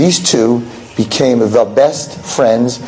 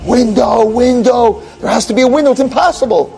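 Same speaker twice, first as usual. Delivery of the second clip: higher, frantic pleading; desperate searching; 9 semitones higher than usual. Acted. [name] feels the wall for a window, higher and frantic. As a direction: grope for it, refusing to believe.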